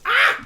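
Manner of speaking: sudden loud cry of pain; pained yelp. Real, not acted. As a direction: yelp from a calf cramp.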